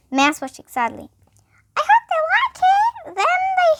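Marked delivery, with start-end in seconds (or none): 1.8-3.4 high, slow mouse squeak; high squeaky little mouse. Acted. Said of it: "he squeaked". 3.6-3.7 high mouse squeak; squeaky mouse. Acted. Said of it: "he squeaked".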